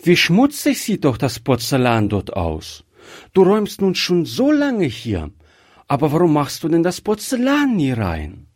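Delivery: put-on high, quick child's voice, scolding gently; a curious little girl wondering aloud; childlike high voice, half reproachful; little girl Gretchen. A narrator's reading.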